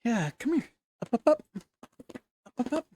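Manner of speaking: high coaxing call; talking to a dog. Real, not acted. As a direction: coax the dog to jump up.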